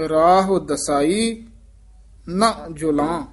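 very slow ਰਾਹ ਦਸਾਈ, pause, then ਨਾ ਜੁਲਾਂ; slow with break. Shown as a demonstration. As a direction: stretch ਰਾਹ ਦਸਾਈ and stop before ਨਾ ਜੁਲਾਂ. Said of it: "teacher models phrasing".

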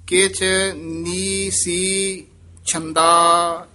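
slow measured chant of scripture; scripture recitation. Acted. Teacher recites Gurbani for students, in a measured chant. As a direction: recite each line with devotion.

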